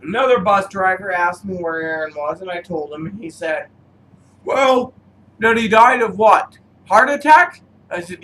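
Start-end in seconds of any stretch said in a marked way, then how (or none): 4.4-7.6 surprised question. Retold or imitated. Bus driver's surprised question.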